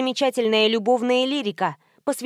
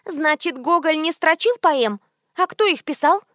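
higher-pitched, put-out questioning; indignant socialite; 6 semitones higher than usual. A narrator's reading. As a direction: demand an answer, pitched up. Indignant friend questions the philologist.

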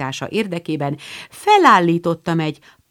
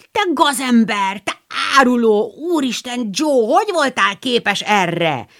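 mock outrage, pitched up; shocked cry; 6 semitones higher than usual. Girls mock-scold Joe for hiding Lori, outraged cry. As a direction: playfully scold the traitor. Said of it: "kiáltotta a három lány".

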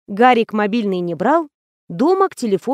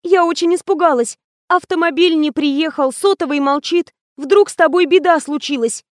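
high, plaintive worry; mildly tearful concern; anxious; 6 semitones higher than usual. A narrator's reading. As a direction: plead in a raised, worried voice. Tearful mistress explains her worry.

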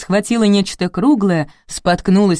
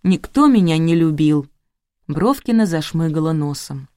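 usual speaking voice

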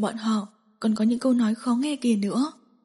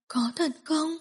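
put-on childlike naive; 6 semitones higher, 6 dB quieter, slower than usual. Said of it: "giống như một đứa bé ngây thơ".

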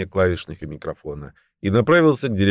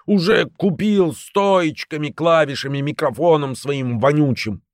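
frantic shouting hostage taker; 7 semitones higher than usual. A narrator's reading. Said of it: "истерично закричал Фикс".

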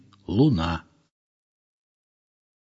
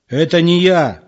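higher, louder, annoyed outburst; irritated exclaim; 6 semitones higher, 5 dB louder than usual. Acted. Egor snaps at the old man, irritated. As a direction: snap back in irritation. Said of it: "раздражённо воскликнул Егор".

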